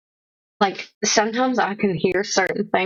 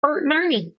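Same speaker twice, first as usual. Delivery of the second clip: put-on old man name call; 8 semitones higher than usual.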